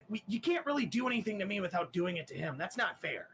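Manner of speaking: rushing to argue it isn't fair; whiny protest. Acted. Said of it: "He's like".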